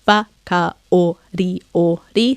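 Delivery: Wa, ka, o, ri, o, ri as six even, separate beats; syllable by syllable. Shown as a demonstration. Teacher counting six syllables, separated beats on Wa, ka, o, ri, o, ri. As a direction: break Wa, ka, o, ri, o, ri into single counted syllables. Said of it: "we count them".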